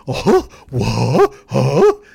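hammed-up shock; scandalised gasps. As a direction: gasp as if about to faint. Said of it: "Democratic guy goes".

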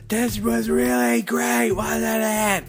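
high, slow shout over noise. Retold or imitated. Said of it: "the whole night was just".

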